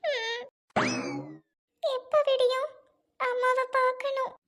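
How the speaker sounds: put-on high, slow childlike whimper; like a little child. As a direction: whine for mother like a small child. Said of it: "சின்ன குழந்தை மாதிரி". (சின்ன குழந்தை போல சிணுங்கல்)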